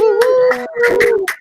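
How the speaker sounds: high, loud whoop; excited whoop. Real, not acted. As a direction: cheer it out. Cheering a prize.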